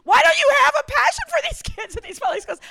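high, loud, frustrated yelling. Retold or imitated. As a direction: hammer every why at him. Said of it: "I mean, I'm just yelling at him".

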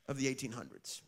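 usual speaking voice

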